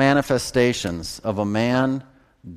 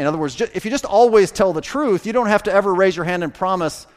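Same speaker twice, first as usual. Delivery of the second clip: much higher, louder preacher's fervor; fervent preaching; 7 semitones higher, 3 dB louder than usual. Real, not acted. Preacher urging congregation to tell the truth, fervent. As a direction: lift the congregation with rising passion.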